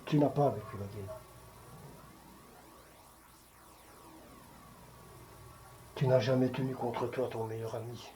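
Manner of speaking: low, soft and sombre; grave retenue. Acted. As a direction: let it sink quietly, with long pauses. Acted evocation of friend dying in war. (voix basse et grave, tout en retenue)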